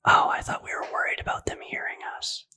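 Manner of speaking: fast conspiratorial whisper; whisper yelling. Real, not acted. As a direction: whisper quickly like sharing a secret. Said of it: "Why are we whispering?".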